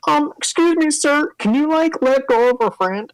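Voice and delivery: high, meek nerdy voice; timid larper. Acted. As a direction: ask politely and nervously. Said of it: "they're like".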